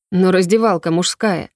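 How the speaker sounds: ordinary delivery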